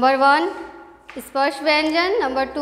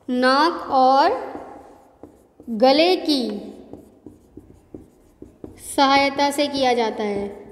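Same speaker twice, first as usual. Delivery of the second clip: slow dictation; slower than usual. Shown as a demonstration. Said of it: "इसका उच्चारण".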